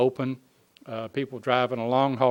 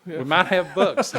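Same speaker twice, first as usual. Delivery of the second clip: slow, laughing tease; joking through laughter; 6 semitones higher, 6 dB louder, slower than usual. Pretend. Laughing joke reply.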